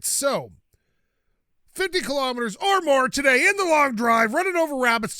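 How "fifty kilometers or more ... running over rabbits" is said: higher and louder, a playful announcer voice; mock announcer hype. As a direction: sell the challenge like a game show host. Announcing the day's challenge.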